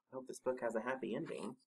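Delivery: usual speaking voice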